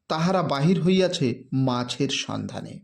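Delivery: usual speaking voice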